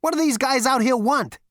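loud, very high, exasperated question; angry demand. A narrator's reading. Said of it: "said Zaphod angrily".

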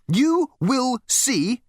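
high, very slow and firm. A narrator's reading. Girl overriding Ford, firm. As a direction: spell it out firmly. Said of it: "continued the girl firmly".

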